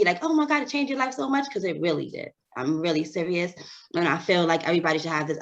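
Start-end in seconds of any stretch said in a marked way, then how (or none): 0.2-1.5 high, fast, over-the-top gush; gushing movie line. Retold or imitated. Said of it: "like, straight out of a movie".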